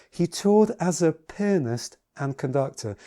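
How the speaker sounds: slow down and bring out "pianist"; "pianist" emphasized and drawn out, set apart from the rest; stress on pianist. Shown as a demonstration. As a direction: lean slowly into the word "pianist". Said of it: "let me bring out the word pianist".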